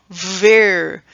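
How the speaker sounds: "ver" held long on its vowel; stressed final syllable. Shown as a demonstration. Teacher marking where counting stops, "ver" stretched out. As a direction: draw out "ver" as the tonic syllable. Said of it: "a sílaba tônica aqui".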